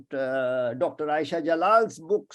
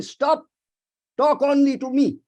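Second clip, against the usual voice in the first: higher, louder mock insistence; imitated demand; 8 semitones higher, 7 dB louder than usual. Lecturer voicing a questioner who wants all his attention, mock demanding. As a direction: put on an insistent listener's demand, raised in pitch.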